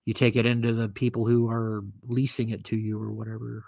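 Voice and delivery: ordinary talk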